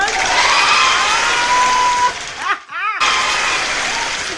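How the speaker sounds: a long, high, shouted laugh. Real, not acted. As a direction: cackle with delight. Closing laugh.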